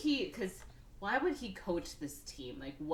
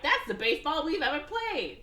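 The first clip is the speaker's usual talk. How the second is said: put-on higher, louder kid voice; lightly voiced happy kids; 8 semitones higher, 5 dB louder than usual. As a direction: cheer like a proud child. Kids happy after losing.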